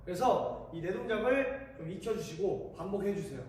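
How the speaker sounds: ordinary talk, nothing marked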